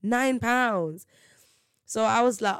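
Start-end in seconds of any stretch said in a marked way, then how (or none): 0.0-1.1 higher, voiced disbelief at the price; retold disbelief. Speaker recalls reacting to the nine pounds price, disbelief. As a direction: repeat the price aloud, pitched up in shock.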